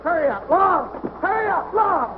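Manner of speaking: high, urgent holler; panicked calling. Acted. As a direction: yell for rescue as danger closes in. Train bearing down on him.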